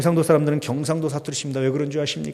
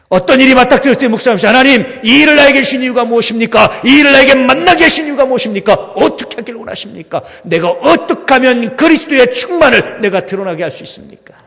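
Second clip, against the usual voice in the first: much higher and louder, fast, pleading prayer questions; preaching at full force; 13 semitones higher, 14 dB louder than usual. Acted. Pastor modelling a prayer for the congregation, full-force preaching.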